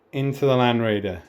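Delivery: ordinary delivery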